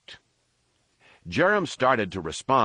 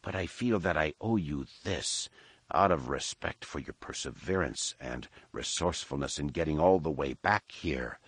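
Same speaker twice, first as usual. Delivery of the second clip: gloating alien warrior; 4 semitones lower, 6 dB quieter than usual. A narrator's reading. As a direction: savour every threat coolly. Villain voice held.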